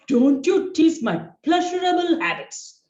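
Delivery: high, loud defensive shout; Tom shouting back. Acted. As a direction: shout him down. Tom snaps back at Jerry.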